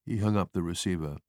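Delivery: ordinary delivery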